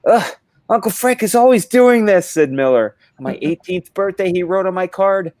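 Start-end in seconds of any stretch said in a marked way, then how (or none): 0.1-2.3 high, loud put-on whine of annoyance; exasperated niece. Niece complains about her uncle, high mock exasperation. 3.2-5.3 high put-on niece voice; exasperated niece. Niece tells of her uncle's birthday card, high mock annoyance.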